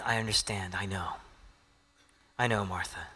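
soft, hushed comfort to a hurting friend; tender whisper. Acted. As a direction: whisper it gently, like consoling someone in tears. Jesus comforting Martha, voiced.

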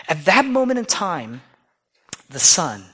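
usual speaking voice

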